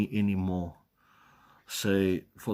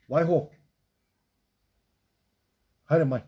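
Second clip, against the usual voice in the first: short, higher-pitched commands; calling the dog; 5 semitones higher than usual. Real, not acted. Streamer breaking off to tell the dog to leave it and come, calling. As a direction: call the dog off in brief raised words.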